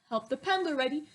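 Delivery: ordinary delivery, nothing marked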